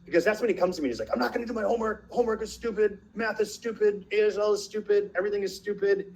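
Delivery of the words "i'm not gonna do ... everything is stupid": imitating a whiny refusal, pitched high; complaining kid. Son refusing homework to his parent, complaining in a high voice. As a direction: protest like a fed-up kid, high and steady.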